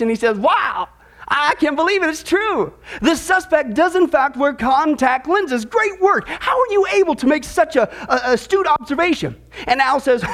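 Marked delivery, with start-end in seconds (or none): 0.4-9.4 high-pitched, delighted disbelief; beaming amazement. Acted. Policeman back from checking the suspect's file, beaming. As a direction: heap amazed praise through a big smile. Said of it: "comes back with this beaming smile on his face".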